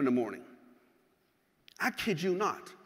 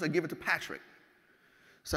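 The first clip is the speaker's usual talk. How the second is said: usual speaking voice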